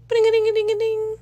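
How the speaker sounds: high ringing phone sound; high mock ringtone; imitated phone ring. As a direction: sing it like a ringtone.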